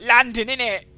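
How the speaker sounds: loud, high Cockney impression of Nan; mock London accent. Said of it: "whereas Nan was all like".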